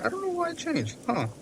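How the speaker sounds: usual speaking voice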